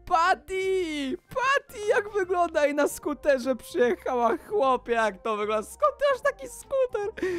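high-pitched, amazed calling out; thrilled exclaiming. Pretend. Gamer sees friend Patty arrive by scooter, thrilled exclaiming. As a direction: squeal the greeting in excited disbelief.

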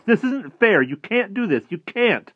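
high, loud, desperate objection; yelled protest. A narrator's reading. Harvey railing at the house over Wendell, yelling. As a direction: protest upward at full voice. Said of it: "Harvey yelled up at the house".

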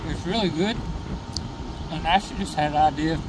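talking through a mouthful of food; words round a bite of sandwich; mouth full. Real, not acted. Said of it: "Excuse me, caught my mouthful".

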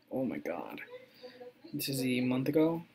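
ordinary delivery

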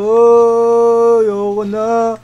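loud "oh" easing into a plea; scared wailing. Real, not acted. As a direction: wail that you want out. Scared player wants to stop.

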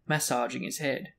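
plain reading voice

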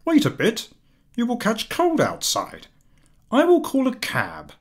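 a husband fussing over his wife in the cold; husband pleading. A narrator's reading. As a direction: hold her back gently but urgently. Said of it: "Loisel held her back, saying".

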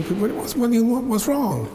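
high, impatient demand; mother fed up with his evasions; exasperated mother. Retold or imitated. Mother losing patience.

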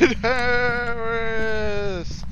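slow spooky summon. Pretend. Summoning ghost by name.

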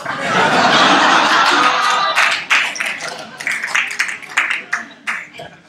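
loud, high, airy long laugh. Real, not acted.

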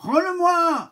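very high loud insisting cat; the tomcat demands it back; insistent cat. Acted. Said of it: "insiste le matou".

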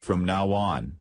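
ordinary delivery

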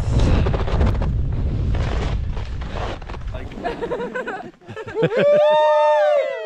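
long high ringing laugh. Real, not acted. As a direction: let the laugh ring out and run on. Laughing at the ski view.

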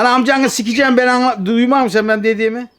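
yelling in real anger; furious, shouting down the phone; angry shout. Real, not acted. Prank call victim loses temper.

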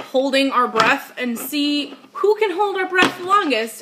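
usual speaking voice

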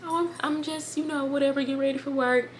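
mock breezy brush-off, a bit strained; faking being fine. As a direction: hide the tears from the boyfriend. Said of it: "I'm like".

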